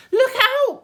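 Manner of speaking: high, loud warning call; James crying out. Acted. James warning the others of the hailstones, loud and high. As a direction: warn everyone sharply. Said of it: "cried James".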